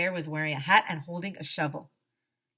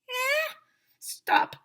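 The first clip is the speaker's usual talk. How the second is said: bear shouts stop. Acted. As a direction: throw up a hand and call out high. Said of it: "shouted the bear".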